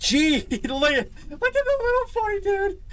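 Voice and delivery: very high, laughing coo at a creature; high squeaky baby voice; cooing baby talk. Acted. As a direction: talk to it like a cute pet. Coos at little creature.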